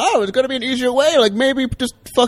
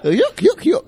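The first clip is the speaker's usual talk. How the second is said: put-on old prospector laugh; 6 semitones higher, slower than usual. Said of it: "It's an impression I do".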